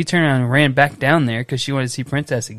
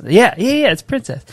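put-on high, a bit louder child's yes; little girl; 9 semitones higher, 4 dB louder than usual. Dad voicing his daughter's eager reply, high childlike. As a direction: bounce it like a thrilled kid. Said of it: "She says".

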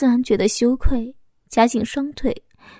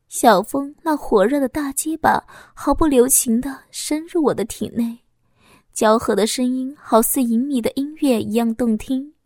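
ordinary delivery, nothing marked

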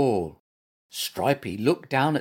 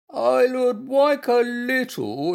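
high, slow invalid voice; pitiful pleading; 12 semitones higher, 5 dB louder, slower than usual. A narrator's reading. Bear pretending illness wants breakfast, high pitiful plea. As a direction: plead slowly as a poorly bear.

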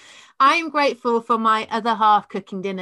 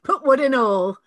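broad northern joke; 4 semitones higher, 5 dB louder than usual. Pretend. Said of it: "up north".

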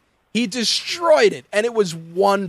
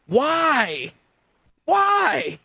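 incredulous why; 7 semitones higher than usual. Retold or imitated. Mocking the woman's bird face.

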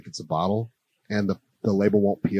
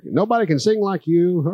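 high, hammed-up impression of the host; retold radio-host voice; 8 semitones higher than usual. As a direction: imitate an animated announcer, pitched up. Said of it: "talking like I talk".

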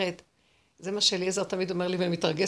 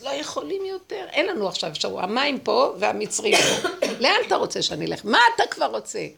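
acted cry, building louder; desperate outcry; 4 semitones higher, 6 dB louder than usual. Crying to God like Israel trapped at the sea, desperate outcry. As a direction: cry out in protest, louder as it goes. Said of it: "צעקתי אליו".

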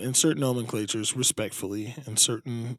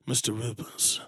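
low, slow sinister host; mock-ominous twist-ending voice; ominous reveal; 6 semitones lower, 5 dB quieter than usual. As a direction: deliver the verdict slowly, dropping very low. Acting the reveal to gambler.

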